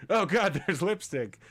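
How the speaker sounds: mock panic. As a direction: act out a brief panicked reaction. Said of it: "just, like, a very quick".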